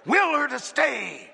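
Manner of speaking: urgent, from a tight hiss to near shouting; hissed urgent command. A narrator's reading. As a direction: hiss it, building to a high, loud cry. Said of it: "And then he hissed".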